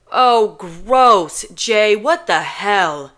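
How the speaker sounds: loud, slow, grossed-out outburst; loud, drawn-out disgust. Acted. Kenji disgusted at her face.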